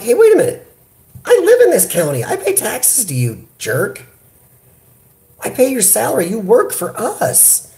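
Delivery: replaying his outraged reply to the official; retold indignant comeback. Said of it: "And I'm like".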